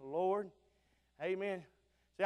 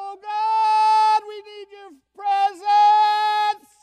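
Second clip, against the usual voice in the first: much higher, much louder, very slow wailing cry; Sister Fossett's wailing prayer; 14 semitones higher, 15 dB louder, much slower than usual. Retold or imitated. Sister Fossett on her knees crying out to God, high and loud. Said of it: "And she'd start".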